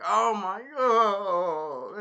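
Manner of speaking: high, loud, long-drawn mock moan; mock pained groan. Beaten spear hero walking off, hammed-up groaning. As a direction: wail it slowly like someone hurt.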